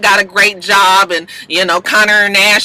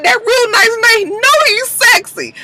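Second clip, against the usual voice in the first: much higher, swooning squeal with a slow, teary laugh; turned on; slower than usual. Real, not acted. Host swooning over Real Nice Nate, high giddy squeal. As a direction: melt over the handsome man. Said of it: "I was turned on a little bit".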